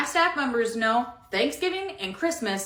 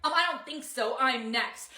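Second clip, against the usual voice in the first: usual speaking voice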